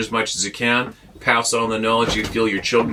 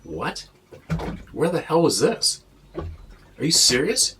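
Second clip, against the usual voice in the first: higher, stunned disbelief, slow at the end; imagined shocked reaction; 4 semitones higher, 3 dB quieter, slower than usual. Retold or imitated. Imagining a friend's sighting, higher stunned disbelief. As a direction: ask it like you can't believe your ears. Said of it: "I'd be like".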